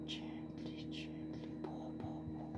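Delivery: hushed whisper urging the pour; barely audible coaxing whisper; whispering to herself. Real, not acted. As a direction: whisper it under your breath as you pour. Pouring through the filter.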